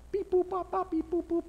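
high, monotone beep-boop dial tones; imitated phone dialing. Pretending to dial Peter for backup, high mimicked phone beeps. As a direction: sing out little phone keypad beeps on one high pitch.

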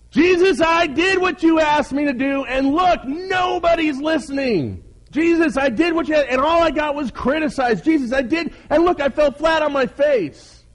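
very high, loud, hammed-up whine; whining complaint. Failed leader protesting to Jesus that nobody listens, whining loudly. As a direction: wail your grievance at the top of your range.

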